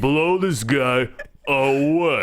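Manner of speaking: slow action-star impression; Stallone. Repeating the catchphrase correction, slow action-star impression. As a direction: drag it out like a tough movie star. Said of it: "your Stallone".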